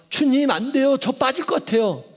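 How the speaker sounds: a sinking man suddenly afraid; panicked cry. Acted. Peter sinking in the water, crying to the Lord in panic.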